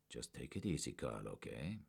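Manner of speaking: low, soft and soothing; quietly calm the partner down; soft calming plea. A narrator's reading. Werner soothing his jumpy partner, softly. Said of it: "Werner offered".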